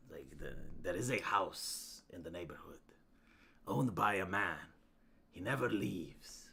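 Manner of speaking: low, hushed campfire legend voice; whispered urban legend; spooky storyteller. Acted. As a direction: tell it like a ghost story, hushed. Ghost story telling.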